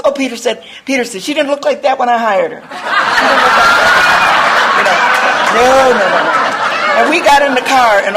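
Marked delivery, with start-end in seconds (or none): 1.2-6.4 laughing quote. Retold or imitated. Quoting Peter, laughing.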